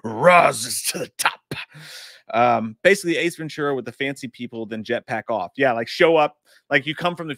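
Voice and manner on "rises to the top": drawn-out, heavy put-on growl; slow, theatrical character voice; put-on catchphrase voice. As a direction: stretch it out like a showman's catchphrase. Quoting a wrestler catchphrase, performed.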